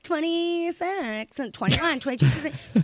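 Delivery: high, drawn-out mock girly answers; imitated young women. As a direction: mimic each age up high. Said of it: "this girl's like".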